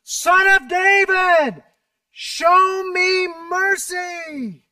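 very slow, loud, high pleading cry; desperate shout. A narrator's reading. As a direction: cry out with everything you have. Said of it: "He shouted even louder".

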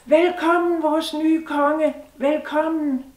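high, loud, slow cheering shout; crowd shouting. Acted. Townspeople shout a welcome to their new king. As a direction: hail him like a whole cheering crowd. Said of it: "og de råbte".

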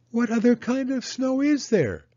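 put-on high, wondering child's voice; little Penny asking her mama, high and curious; curious little girl. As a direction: ask it as a small girl, pitched high. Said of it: "asked Penny".

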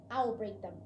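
usual speaking voice

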